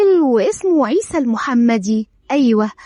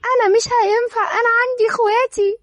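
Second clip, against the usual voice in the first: much higher, desperate crying plea; tearful plea; 9 semitones higher, faster than usual. A narrator's reading. Dareen begging Eyad to let her go, tearful. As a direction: beg through tears. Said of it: "دارين بدموع".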